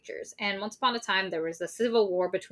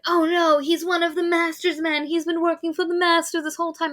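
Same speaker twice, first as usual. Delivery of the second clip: alarmed gasp; 9 semitones higher than usual. Retold or imitated. Lainey's alarmed reaction, voiced.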